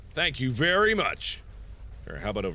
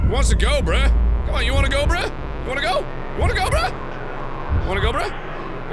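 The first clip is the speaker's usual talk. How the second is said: high-pitched mock tough-guy taunting; cocky challenge; 10 semitones higher, 6 dB louder than usual. Player daring the ghost to fight, cocky challenge. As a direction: goad them into a fight, pitched way up.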